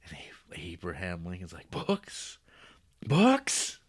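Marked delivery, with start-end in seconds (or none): 1.7-3.8 high, building mock-excited repeat; hammed-up gasp of delight; excited Lincoln. Lincoln thrilled to hear about books, high mock excitement.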